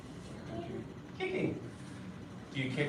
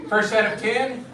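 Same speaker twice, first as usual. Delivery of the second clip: loud, higher drill command; calling out; 7 semitones higher, 9 dB louder than usual. Real, not acted. Instructor to kids before push-ups, calling out. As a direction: call it out to the whole class.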